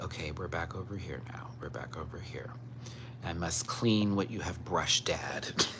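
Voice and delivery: the cat grumbling as he licks his coat; fussy cat voice. Retold or imitated. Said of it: "now he's like".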